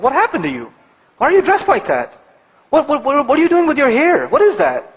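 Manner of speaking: high-pitched, incredulous parent questioning; exasperated parent. Retold or imitated. Parent to unrecognizable teenage child, incredulous and high-pitched. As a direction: imitate a baffled parent scolding a teenager.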